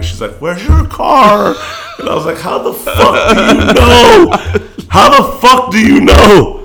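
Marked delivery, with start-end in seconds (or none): 0.4-1.5 high, slow mock sob of a crying mom; mom sobbing. Son retells mom crying on the phone, high slow wail. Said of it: "sobbing on the phone, crying". 2.4-6.5 high, loud, slow stunned outburst; disbelief. Retold or imitated. Son stunned mom already knows, loud high laughing disbelief.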